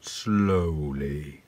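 drawn-out, low slowly. Shown as a demonstration. Introducing a slow poem, word drawn out. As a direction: stretch the word right out. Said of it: "to be read slowly".

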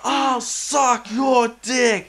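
put-on high, loud, slow drunken shout; drunk yelling. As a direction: bellow it like a hammered bloke. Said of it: "He's like".

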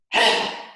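big noisy sigh out; loud volcano breath out; loud tantrum exhale. Shown as a demonstration. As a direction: blow it out like a volcano. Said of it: "Let's do that again".

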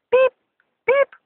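high, loud spoken beep; mock censor beep. Vlogger censoring own swearing, mock censor beep. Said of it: "beep that out".

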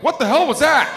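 a spooked cat asking what happened; high, loud alarmed cat voice; startled cat. Retold or imitated. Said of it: "The cat looked at me like".

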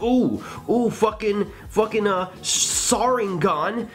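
hammed-up boast, drawing out the last word; mock bragging. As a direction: imitate an anime fighter showing off. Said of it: "And then they brag about it".

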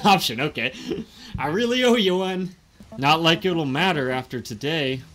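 put-on eager lovesick Southern accent; hopeful young man, Southern accent. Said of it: "why do I keep going into a southern accent".

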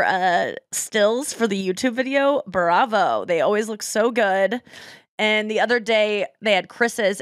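ordinary talk

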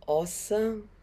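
ordinary talk, nothing marked

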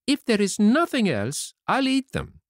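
put-on very high-pitched childish voice; puppet Pinocchio. Puppet agrees to eat skins and cores, high childish voice. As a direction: concede in a high puppet squeak.

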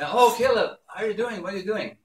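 high, delighted phone greeting; doting grandparent. Retold or imitated. Grandparents greeting grandson on the phone, high excited fussing.

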